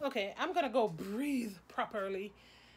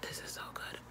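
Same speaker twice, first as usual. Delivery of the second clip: whisper; 13 dB quieter, faster than usual. Real, not acted. Repeat whispered.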